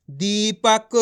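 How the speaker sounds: दीपक stretched, with a long ee in दी; drawn-out reading. Shown as a demonstration. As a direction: sound दीपक out slowly, syllable by syllable. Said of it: "खींच खींच के पढ़ना है".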